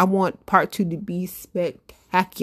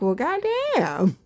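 impressed; 6 semitones higher, slower than usual. Retold or imitated. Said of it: "I'm like".

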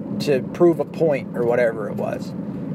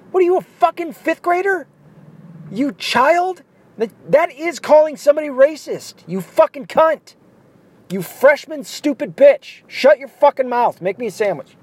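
mock shrill rant; 9 semitones higher than usual. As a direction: scream it up high like a bully mocking her.

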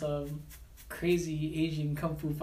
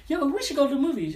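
eager invite; 9 semitones higher, 4 dB louder, faster than usual. Retold or imitated. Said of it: "Has somebody ever been like".

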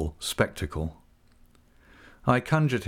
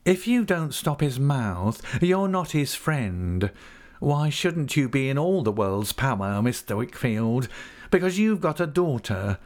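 high, insinuating character voice; warn, then wheedle with false humility; sly underling pressing his hold; wheedling schemer; 5 semitones higher than usual. Acted. Narrator voices Uriah warning, wheedling.

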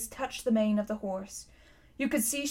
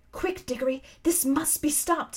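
a touch higher, pressing and insistent; urgent plea; 4 semitones higher than usual. A narrator's reading. Girl urging her friend to stop the witch, urgently. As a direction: urge a friend to act at once. Polly urgently intervenes beside Digory.

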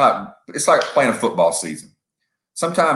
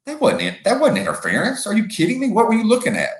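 much higher, incredulous protest; indignant rival fan; 11 semitones higher than usual. Retold or imitated. Fan protesting a referee's interference call, high incredulous whine. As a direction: play a fan outraged by the call. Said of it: "the team that they were for goes".

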